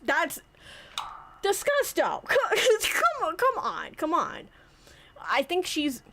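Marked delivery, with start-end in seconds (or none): 0.0-4.5 shocked laughing. Pretend.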